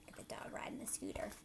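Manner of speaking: soft unvoiced aside; whisper. Real, not acted. Teacher whispering to listening kids about the dog on the scooter.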